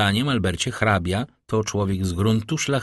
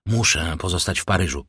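plain reading voice

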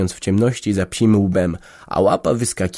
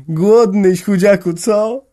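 tender; 11 semitones higher, 6 dB louder, slower than usual. A narrator's reading. Boy tenderly greets dog.

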